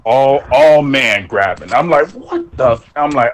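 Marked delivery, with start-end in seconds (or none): 2.1-2.9 shocked outburst. Retold or imitated. Shocked at grabbing.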